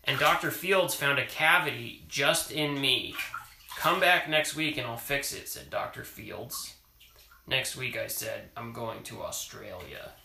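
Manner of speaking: reading a picture book aloud; story reading. Acted. Parent reading a picture book to child, storytelling voice. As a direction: read it to a child at bedtime.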